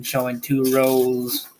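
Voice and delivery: ordinary delivery, nothing marked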